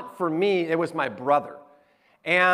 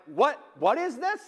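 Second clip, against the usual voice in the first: higher, rising mock bewilderment; puzzled; 6 semitones higher than usual. Wife and daughters opening pillowcases, imitated puzzlement. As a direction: imitate someone baffled by an odd present. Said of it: "trying to make sense of it".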